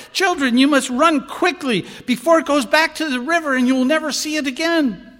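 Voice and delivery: high, loud urgent alarm; excited warning. Acted. Cantor tricking the children with dragon tale, urgent alarm. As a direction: raise the alarm to send the kids off.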